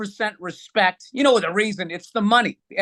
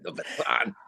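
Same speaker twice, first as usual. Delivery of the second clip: whispered, amused repeat; co-host teasing Paul's word "baton" in a whisper; mocking whisper; 9 dB quieter, slower than usual. Real, not acted. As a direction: breathe the word back at him, teasing. Mocking co-host's word choice.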